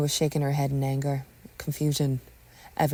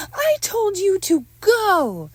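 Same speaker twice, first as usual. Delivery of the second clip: high, loud and drawn out; slow, high-pitched angry shout; furious yell; 8 dB louder, much slower than usual. Acted. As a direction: bellow it slowly at an intruder. Yelling at returning intruder.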